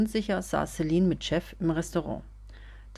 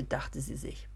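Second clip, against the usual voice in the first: breathy near-whisper, much softer; whispered narration tag; 4 semitones lower, 10 dB quieter than usual. A narrator's reading. Narrator voicing Celine's thought tag, whispered.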